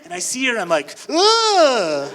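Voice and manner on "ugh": high, loud startled grunt with a laugh. Retold or imitated. Scared rider startled by skeleton actress, yelping high and loud. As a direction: jolt back in comic fright.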